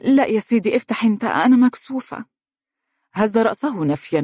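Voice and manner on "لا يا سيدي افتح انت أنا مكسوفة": high-pitched bashful refusal; shy away with a coy little protest. A narrator's reading. New bride to husband, refusing to open the door, bashful. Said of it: "قالت بخجل".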